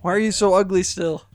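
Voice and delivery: high, loud teasing jab; teasing shout. Acted. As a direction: shout the insult playfully. Teasing the returning friends.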